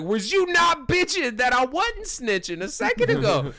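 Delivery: high, loud mock-outraged rant; hammed-up yelled comeback; indignant rant. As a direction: shout the rhetorical question in disbelief. Acts out his indignant inner rant.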